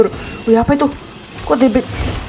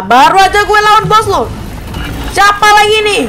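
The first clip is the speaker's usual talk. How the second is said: louder, higher, fed up at another boss; exasperated outburst; 7 semitones higher, 4 dB louder than usual. Real, not acted. Gamer protesting a new boss, exasperated loud outburst. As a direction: groan it out loud at the screen.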